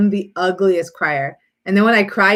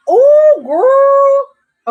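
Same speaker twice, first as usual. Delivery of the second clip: delighted squeal; 9 dB louder than usual. Real, not acted. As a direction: gush over a friend. Delighted reaction to the viewer.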